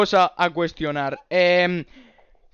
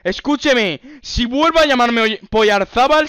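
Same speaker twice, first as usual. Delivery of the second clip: raised, higher indignant warning; mock threat; 5 semitones higher, 4 dB louder than usual. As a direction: warn them off with put-on outrage. Threatening over the mangled surname. (amenaza indignada de falso policía)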